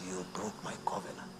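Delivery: hushed, mostly whispered rebuke; God whispering judgment on the covenant breaker. Acted. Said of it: "He says".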